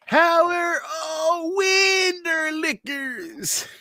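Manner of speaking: very high, stretched-out greeting cry; drawn-out hailing call. Pretend. Streamer greeting his chat, drawn-out hailing call. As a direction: hail the crowd, stretching every word.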